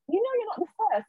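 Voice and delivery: very high imitated vicar voice; happy vicar. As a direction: reassure her with delighted excitement. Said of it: "so happy for me".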